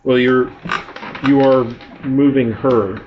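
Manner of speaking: usual speaking voice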